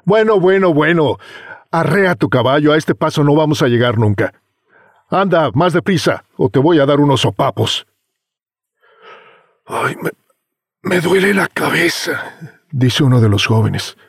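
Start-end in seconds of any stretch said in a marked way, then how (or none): 0.1-7.9 higher pushy bossy voice; impatient young man. Acted. Young man hurries the driver. 9.7-12.4 whiny hungover voice. Acted. Hungover youth complains.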